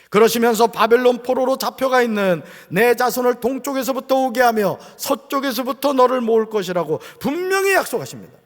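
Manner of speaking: loud, sustained pulpit proclamation; forceful sermon delivery; preaching. Real, not acted. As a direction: declare the promise to the congregation.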